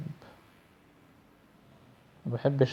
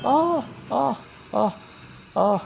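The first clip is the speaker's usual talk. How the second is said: high frightened cries; screaming; 10 semitones higher than usual. Real, not acted. Gamer screaming as Slendrina catches him. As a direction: let out sharp screams of fright.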